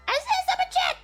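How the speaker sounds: light up at the donation; excited. Real, not acted. Spotting a donation.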